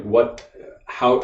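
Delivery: ordinary talk, nothing marked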